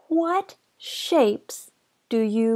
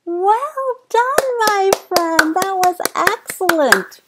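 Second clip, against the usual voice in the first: excited praise; 8 semitones higher than usual. Real, not acted. Praising child after song.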